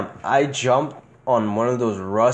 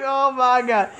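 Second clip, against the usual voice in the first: high-pitched retold "oh my God" through laughter; laughing mock dismay; 11 semitones higher, slower than usual. Patient reacting to the doctor's tetanus news, laughing mock dismay. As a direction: laugh while imitating your own shocked reaction. Retelling shock at tetanus diagnosis.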